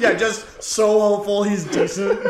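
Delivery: loud, high laughing delivery. Real, not acted. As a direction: crack up while saying it. Joking about a singer.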